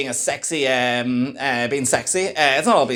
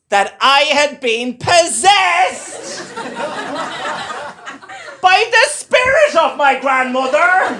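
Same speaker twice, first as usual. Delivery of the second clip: very slow, higher and louder, a drawn-out strange voice; slow otherworldly granny voice, pushed high and loud; possessed reading voice; 10 semitones higher, 4 dB louder, slower than usual. Shown as a demonstration. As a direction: read like a grandmother's spirit has taken over. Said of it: "So I started to read like this".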